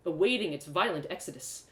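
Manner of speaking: ordinary delivery, nothing marked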